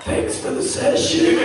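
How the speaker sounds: high, strained, voiceless croak; no voice left. Retold or imitated. Actor after four hours of goblin screams, voice gone. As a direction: squeeze it out with a wrecked voice. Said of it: "no voice left".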